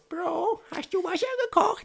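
very high mock falsetto; imitating roommate. Roommate asks whether the water is boiled, high-pitched mock voice. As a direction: squeak it out like a needy roommate. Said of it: "Er so".

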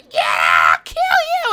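loud imitated monster shriek; screaming in character. Scare actor threatening whoever kicked her, loud mock scream. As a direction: scream it wildly, staying in character. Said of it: "she was screaming".

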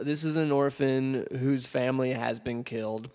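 ordinary talk, nothing marked

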